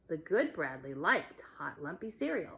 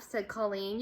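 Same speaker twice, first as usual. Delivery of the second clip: ordinary delivery, nothing marked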